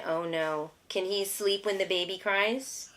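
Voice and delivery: ordinary delivery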